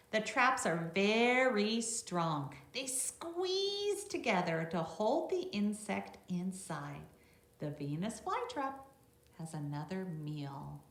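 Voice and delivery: bright read-aloud voice for a class; reading to children. Acted. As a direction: read it out clearly for the little ones. Reads the book text to children.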